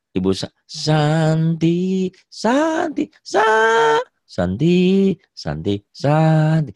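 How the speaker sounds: Santi called out very high and drawn out; teacher showing how a name is called; calling a name. Shown as a demonstration. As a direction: call Santi across the house, rising to a shout. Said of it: "sama aja gitu ya".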